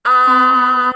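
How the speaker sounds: Ah high and level, held; Ah as a high level Mandarin tone; tone one. Shown as a demonstration. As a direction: sing Ah flat and high for the class. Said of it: "nada yang datar dan tinggi".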